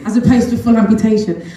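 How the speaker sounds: laughs through the words; real laughter at the absurdity; amused, laughing aloud. Real, not acted. Laughing at the doctors' logic.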